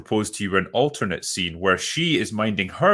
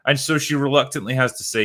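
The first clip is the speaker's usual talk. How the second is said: usual speaking voice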